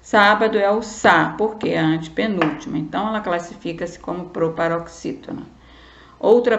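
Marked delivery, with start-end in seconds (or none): none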